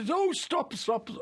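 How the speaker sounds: high, urgent cry of stop stop; sudden stop. Retold or imitated. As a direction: cut the actor off mid-line. Director halting the take.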